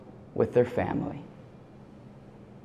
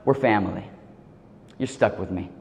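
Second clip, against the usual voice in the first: tender reassurance; 8 semitones lower, 9 dB quieter than usual. Retold or imitated. Teacher reassuring the crying student.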